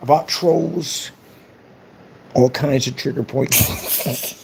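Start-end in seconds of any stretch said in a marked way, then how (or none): none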